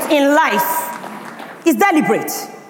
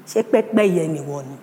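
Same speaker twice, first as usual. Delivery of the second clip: grandmother voice; 8 semitones lower, 4 dB quieter than usual. Retold or imitated. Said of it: "And she said".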